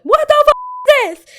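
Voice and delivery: high, loud, drawn-out shriek of disbelief; mock horrified screech; shocked shriek. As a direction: scream it in outrage, dragged slow. Shocked at friends on her step.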